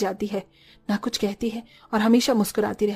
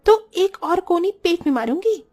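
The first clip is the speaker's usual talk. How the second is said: mock scolding; 5 semitones higher than usual. Wife scolds husband.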